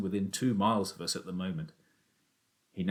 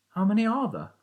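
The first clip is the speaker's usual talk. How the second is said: put-on young Danny, higher, doubtful; boy's voice; 13 semitones higher, 4 dB louder than usual. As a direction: object like a sceptical boy. Danny asks his father.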